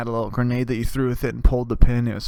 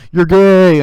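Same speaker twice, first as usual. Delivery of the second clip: high, very loud jeering shout; mock taunt; 6 semitones higher, 15 dB louder than usual. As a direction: yell it like a schoolyard bully. Imagined taunt to younger kid.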